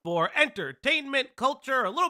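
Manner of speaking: upbeat host opening; show host intro. Acted.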